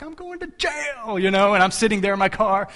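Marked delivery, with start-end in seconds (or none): 0.0-1.2 mock panic.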